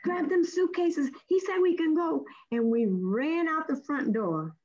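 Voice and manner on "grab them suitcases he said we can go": high, excited call to the group; excited urging. Retold or imitated. Letitia rushing her group through before the officer changes his mind, excited. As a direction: hurry them along, thrilled.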